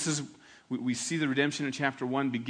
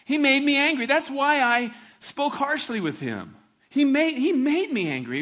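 put-on child's excuse; 9 semitones higher, 7 dB louder than usual. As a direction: whine like a young kid blaming his brother. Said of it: "they'll say things like that".

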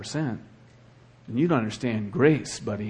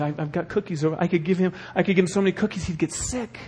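ordinary talk

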